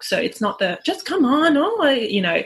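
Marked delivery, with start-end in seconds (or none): 0.8-1.9 high, impatient mock complaint; a hint of a fed-up parent sighing at a kid; exasperated parent. Parent losing patience with a melting-down child, exasperated. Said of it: "so it's bringing it down".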